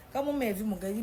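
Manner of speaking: ordinary talk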